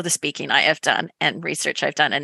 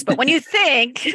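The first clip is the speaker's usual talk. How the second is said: retry it, giggling at your own accent; laughing retake; 8 semitones higher than usual. Real, not acted. Host laughs at her Canadian accent and says it again. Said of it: "there's my Canadian accent coming in".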